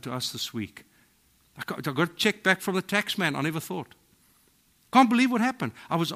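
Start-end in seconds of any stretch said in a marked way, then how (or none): 4.9-5.7 much higher and louder, amazed; excited marvel. Shown as a demonstration. Believer tells friends about a miracle, excited and amazed. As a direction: burst out with wonder.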